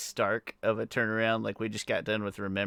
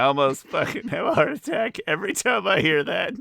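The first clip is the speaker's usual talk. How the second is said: high-pitched, breaking up with laughter; real laughing; 8 semitones higher, 4 dB louder than usual. Real, not acted. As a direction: tell it while cracking up. Cracking up over a favorite joke.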